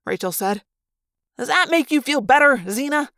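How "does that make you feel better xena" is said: high, irritated jibe; testy, needling retort; grated. A narrator's reading. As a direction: grate out a sarcastic question. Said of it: "Marco grated".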